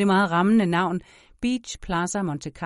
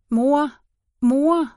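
put-on small child calling out, higher and louder; raised, pleading call; calling for mother; 8 semitones higher, 7 dB louder than usual. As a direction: call out to wake your mother.

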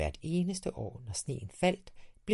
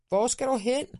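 much higher, louder call; shouting after him; 14 semitones higher, 7 dB louder than usual. A narrator's reading. Dad shouts after his son leaving with the sled. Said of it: "råbte far efter ham".

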